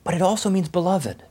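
normal talking voice

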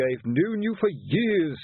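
slow, tired exasperation; weary. A narrator's reading. Colon wearily reproaching Ledgy, slow. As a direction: sigh it out, fed up. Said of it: "said Colon wearily".

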